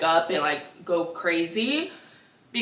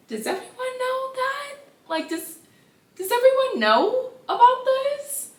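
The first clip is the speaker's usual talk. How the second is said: much higher, stunned squeak of disbelief; shocked disbelief. Retold or imitated. Daughter stunned by her mother's news, high shocked squeak. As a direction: gasp it out like your jaw just dropped.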